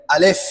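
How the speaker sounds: আলিফ with a puff of air on the final ফ; demonstrating articulation. Shown as a demonstration. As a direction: say আলিফ firmly, letting air escape at the end. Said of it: "একটু বাতাস বের হবে".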